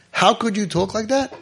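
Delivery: high-pitched, rising scolding question; mock indignation. Onlooker rebuking a kid who curses God, mock indignant. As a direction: imitate a scandalized scolder, voice climbing at the end.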